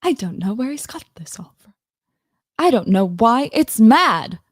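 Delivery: put-on practiced British accent, punching the end; attempted British accent. Streamer trying not to slip into Irish, attempted British accent. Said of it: "I almost did fucking Irish".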